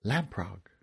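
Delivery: low, hushed single word; whispered. A narrator's reading. Essa calling a firefly in the dark, whispered. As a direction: softly whisper it like a spell. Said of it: "Then she whispered".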